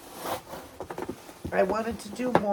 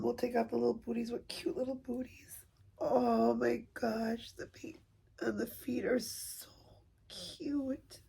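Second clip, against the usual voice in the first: tearful cooing; 5 semitones higher, 5 dB quieter than usual. Real, not acted. Admiring the baby's painted feet, tearfully cooing. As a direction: melt into real tears, soft and high.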